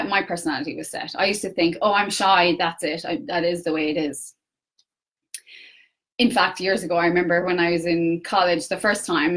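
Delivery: ordinary talk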